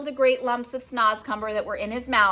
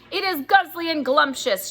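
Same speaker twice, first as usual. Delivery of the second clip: high, louder greedy giant; shout; 8 semitones higher, 4 dB louder than usual. Acted. Bloodbottler defends eating humans, greedy shout. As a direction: shout it with relish. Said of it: "shouted the blood bottler".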